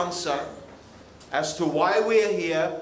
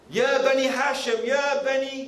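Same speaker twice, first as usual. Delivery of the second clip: higher-pitched acted summons; calling out; 6 semitones higher than usual. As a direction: call out to the tribes from a hilltop. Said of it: "calling everybody".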